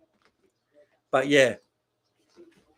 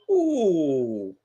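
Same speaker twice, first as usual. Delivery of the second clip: long "ooh" reaction; 2 semitones higher than usual. Real, not acted. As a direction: react to a near moment with a sliding ooh. Reacting to live match action.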